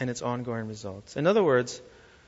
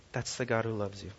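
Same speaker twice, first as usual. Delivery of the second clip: ordinary talk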